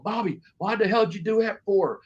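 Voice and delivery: high-pitched retold demand for an explanation; Terry Taylor. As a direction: imitate the boss calling someone out. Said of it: "they started hearing Terry's going".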